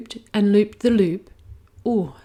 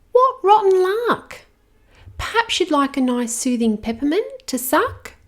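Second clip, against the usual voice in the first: put-on little girl; 10 semitones higher, 3 dB louder than usual. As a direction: soothe the hurt dragon like a little girl. Girl sympathises.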